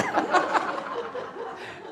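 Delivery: preacher laughing at his own pinching joke, airy high laugh; high breathy laugh. Real, not acted.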